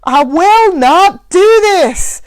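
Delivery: high, loud, slow furious yell. Acted. Mac yelling his refusal at the woman. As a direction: roar it in blind anger. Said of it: "Mac yelled".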